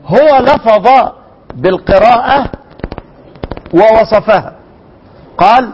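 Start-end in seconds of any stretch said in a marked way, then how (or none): none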